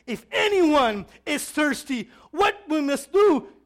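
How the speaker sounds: high, slow, booming call; loud voice. Retold or imitated. Preacher voicing Jesus addressing anyone who is thirsty, loud. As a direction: proclaim it at full volume, words stretched. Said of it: "but in a loud voice".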